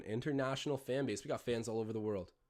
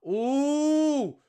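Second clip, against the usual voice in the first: sudden high loud "ooh" of excitement; excited ooh; 13 semitones higher, 10 dB louder than usual. Real, not acted. Fan reacting to a spin move, loud excited ooh. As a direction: gasp it out like a fan seeing a wild move.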